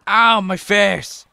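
loud ow, pitch climbing; mock pained yell. Real, not acted. Gamer watching the skater hit face first, mock pained yell. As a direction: yelp as if you took the hit yourself. Character slams face in game.

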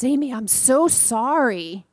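put-on slow, earnest child's apology; little boy apology. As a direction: say it like a sincere small boy. Child apologizes, voiced by teller.

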